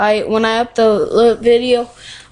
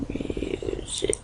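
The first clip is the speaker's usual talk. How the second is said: whispering; 14 dB quieter than usual. Real, not acted. Muttering to self.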